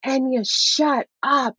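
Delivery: retold shout of a furious mother; retold rage yell. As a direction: hammer out the name, then the order, like losing it. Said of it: "at the top of my lungs, rage".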